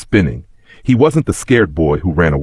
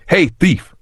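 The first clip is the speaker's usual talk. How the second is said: sharp high accusing shout; 11 semitones higher than usual. A narrator's reading. Big boy shouting at Kofi, accusing shout. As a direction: call him out across the crowd. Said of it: "one of them shouted at Kofi".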